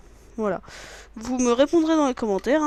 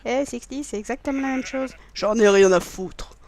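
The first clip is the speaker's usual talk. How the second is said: put-on gruff voice. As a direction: dismiss it in a mock tough voice. Put-on voice to friend.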